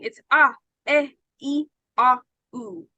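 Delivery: ah, eh, e, ah, oo said long and open; vowel sounds demo. Shown as a demonstration. Teacher sounds out vowels, lips apart. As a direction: sound out ah, eh, e, ah, oo without the lips touching. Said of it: "So when I'm pronouncing".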